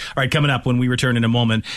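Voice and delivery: ordinary delivery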